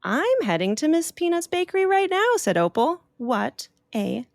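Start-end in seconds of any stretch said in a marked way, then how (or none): 0.0-2.4 higher, gentle goodnight; high little-girl voice. Acted. Opal saying goodnight to the sleepy bat. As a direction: tuck the bat in with it.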